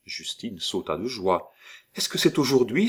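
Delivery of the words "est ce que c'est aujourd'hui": put-on higher, eager child's cry; excited cry. Little girl cries out in hope, higher. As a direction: burst out hopeful. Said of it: "s'écria-t-elle".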